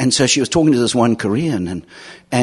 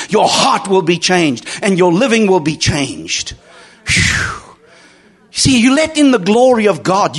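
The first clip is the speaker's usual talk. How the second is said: raised, ringing pulpit voice; fervent preaching; 7 semitones higher than usual. Acted. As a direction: preach it out with conviction to the room. Preaching the grace message.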